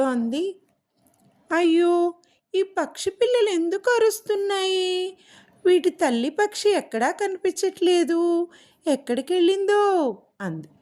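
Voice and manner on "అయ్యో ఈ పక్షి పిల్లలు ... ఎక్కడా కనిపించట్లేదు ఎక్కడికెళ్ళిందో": high parrot voice, a touch of worry; worried parrot. Acted. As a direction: fret like a small parrot about the chicks. Parrot worried about crying chicks. (చిలుక పిల్లల గురించి ఆందోళనగా, ఎత్తైన గొంతుతో)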